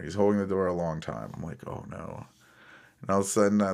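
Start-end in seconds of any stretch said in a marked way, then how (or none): 1.7-2.2 very soft, under the breath; sinking realization, almost whispered; hushed dread. Retold or imitated. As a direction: say it to yourself, barely voiced. Dreading the scooter rider's return.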